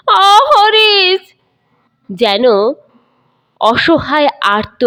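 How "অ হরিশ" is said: slow, high, loud cry for his son. Acted. Grandfather crying out for his son through his tears. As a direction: call out through sobs like a helpless boy. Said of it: "কান্নার মধ্যে ডাকলেন".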